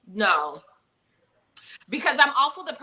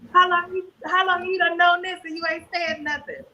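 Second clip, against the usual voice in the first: teasing indignation at a fan, pitched up; mock indignant; 8 semitones higher than usual.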